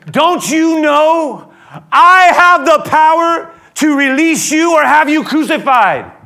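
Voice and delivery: slow, high, very loud threat; indignant shout. Acted. Pilate asserting his power over Jesus, slow indignant shout. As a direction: thunder it out as a man flaunting his power.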